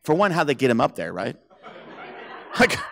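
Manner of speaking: ordinary delivery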